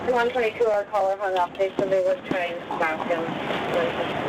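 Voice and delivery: crackly dispatch radio; police radio. Real, not acted.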